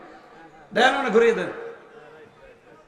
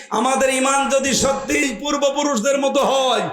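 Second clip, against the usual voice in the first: rousing sermon, words flung out to the crowd; preaching; 3 semitones higher, 4 dB louder than usual. Acted. Preacher rousing the congregation about God's promise, fervent preaching. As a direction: preach it with full fervour.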